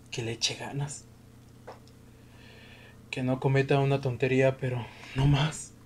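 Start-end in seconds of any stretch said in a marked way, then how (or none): none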